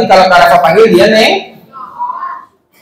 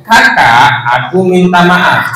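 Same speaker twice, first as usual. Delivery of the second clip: slow, lower-pitched demonstration for copying; model apology; 3 semitones lower, much slower than usual. Shown as a demonstration. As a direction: draw out "aku minta maaf" word by word. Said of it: "ajarin minta maafnya kayak gini".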